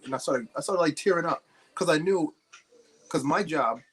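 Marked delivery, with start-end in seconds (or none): none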